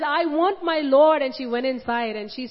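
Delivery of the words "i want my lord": crying plea. Retold or imitated. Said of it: "She cried and she said".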